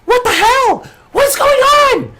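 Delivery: shrill high panicked yelling manager; a little man losing it, yelling way up high; GM voicing a panicked manager. Manager bursting out of his wagon, yelling in alarm. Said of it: "and goes".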